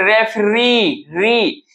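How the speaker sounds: Referee slowly with a long final री; stress on final syllable. Shown as a demonstration. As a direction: draw out री, then repeat it. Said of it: "last में हम लोग ज्यादा उच्चारण करते हैं".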